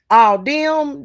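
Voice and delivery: ordinary talk, nothing marked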